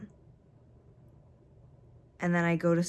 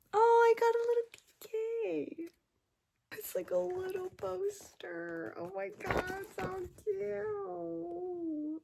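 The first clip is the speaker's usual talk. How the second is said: squealing delight; 13 semitones higher, much slower than usual. Real, not acted. Unboxing K-pop merch alone, high delighted squeal. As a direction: gush in a tiny high voice.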